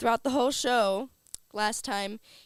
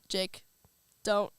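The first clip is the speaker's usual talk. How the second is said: normal talking voice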